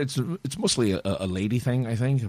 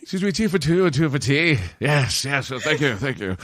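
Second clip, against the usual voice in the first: higher, hammy British delivery; mock British accent; 4 semitones higher than usual. Showing off a new catchphrase, mock British accent. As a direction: announce it in a put-on British accent. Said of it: "I have a terrible British accent".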